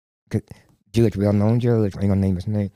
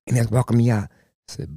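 usual speaking voice

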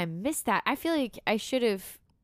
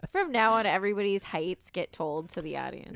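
ordinary delivery

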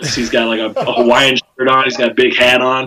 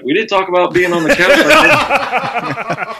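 laughing disbelief; 8 semitones higher, much slower than usual. Retold or imitated. Mocks surprise at couch invite.

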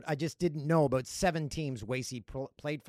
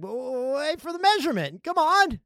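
high, loud, rising urgency; retold protest; 9 semitones higher, 6 dB louder than usual. Urging the curler to await measurement, rising mock protest. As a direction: build from objection to exasperated plea.

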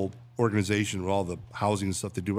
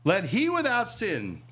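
slow, higher, solemn preacher voice; Jesus pronouncement; 8 semitones higher, slower than usual. Retold or imitated. Jesus addressing the crowd, a slow solemn pronouncement. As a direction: hand down the line like scripture, slowly. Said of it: "famously says".

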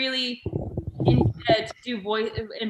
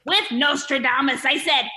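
high, loud, slow squawking comedian; strained nasal-free squawk, slow and loud; Gilbert Gottfried voice; 5 semitones higher, 6 dB louder, slower than usual. Acted. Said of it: "get into my Gilbert Gottfried".

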